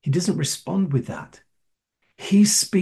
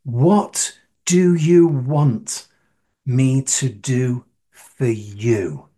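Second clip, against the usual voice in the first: very slow, measured question; Jesus asking; 3 semitones lower, much slower than usual. Retold or imitated. Jesus asks the blind man, slow measured question. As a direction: spell it out word by word, deliberately. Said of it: "Jesus asks".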